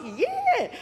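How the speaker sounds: high, drawn-up enthusiastic yes; eager yes. Retold or imitated. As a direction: imitate an overjoyed reply. Acts out Freddie's eager answer.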